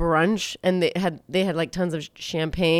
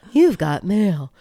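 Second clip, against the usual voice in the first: slow, put-on announcement voice; You've Got Mail voice; slower than usual. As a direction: imitate the mail alert, drawn out. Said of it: "like they do".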